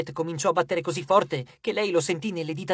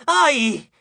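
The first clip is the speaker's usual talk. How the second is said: high, loud yelp; sudden loud ouch; yelp of pain; 8 semitones higher, 7 dB louder than usual. A narrator's reading. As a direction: cry out as if hit. Cry of pain in the scuffle.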